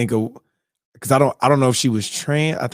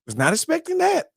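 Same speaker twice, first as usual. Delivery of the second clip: high, stunned disbelief; 12 semitones higher, 3 dB quieter than usual. Retold or imitated. Watching with his wife, high stunned disbelief. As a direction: gasp it out stunned. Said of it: "I said".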